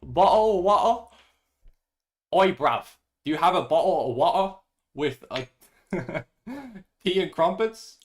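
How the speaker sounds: stumbling through a fake British accent; mock British accent. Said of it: "As a British person, I feel insulted".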